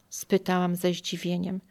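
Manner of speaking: plain reading voice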